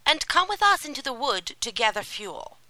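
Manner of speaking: wicked stepmother. A narrator's reading. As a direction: order them out sharply. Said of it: "she said".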